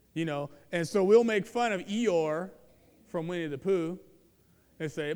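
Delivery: usual speaking voice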